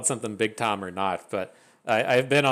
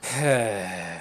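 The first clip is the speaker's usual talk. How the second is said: exasperated sigh. Real, not acted. After a misclick.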